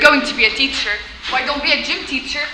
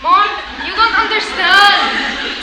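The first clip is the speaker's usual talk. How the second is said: drawn-out, raised wail; exasperated protest; 5 semitones higher, 6 dB louder, much slower than usual. Acted. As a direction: stretch it out in loud teenage protest. Daughter protests mother's dramatic reaction.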